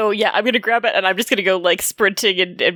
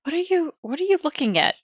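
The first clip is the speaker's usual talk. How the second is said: high, puzzled question; acted bewildered query; puzzled challenge; 7 semitones higher, 3 dB quieter than usual. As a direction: ask it high, a bit softer. Acted in character, puzzled by counting.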